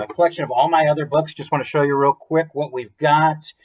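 ordinary delivery

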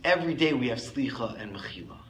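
ordinary talk, nothing marked

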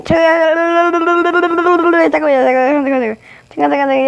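high, loud rapid wait wait wait; panicked pleading. Real, not acted. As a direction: beg for a second under fire. Panics under attack.

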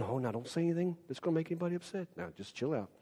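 very soft, quick pacifying voice; hushed mock appeasement; timid peacemaker. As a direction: imitate someone hushing everybody down. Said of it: "somebody who walks around going".

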